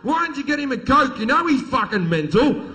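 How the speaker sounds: loud, high exasperated scolding; furious mum. Retold or imitated. Mum turns on the blameless brother, loud furious scolding. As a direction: imitate a mum yelling at the wrong son. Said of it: "she's fucking furious".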